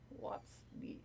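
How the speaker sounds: hushed whisper. Real, not acted. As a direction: breathe it under your voice. Whisper unvoiced.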